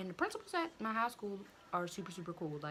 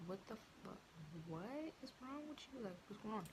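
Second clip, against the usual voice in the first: mom's shocked question; 3 semitones higher, 9 dB quieter, faster than usual. Retold or imitated. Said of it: "she was just looking at me and was like".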